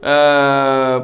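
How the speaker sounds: ordinary talk, nothing marked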